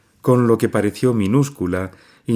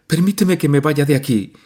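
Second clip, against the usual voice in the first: higher and a bit louder, begging; imploring; 8 semitones higher, 4 dB louder than usual. A narrator's reading. Nadia finally imploring her mother, begging. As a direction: plead for release after long crying. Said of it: "imploró por fin".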